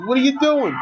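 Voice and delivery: high, incredulous question; baffled at the character's choice; exasperated at the screen. Real, not acted. Yelling at the movie character.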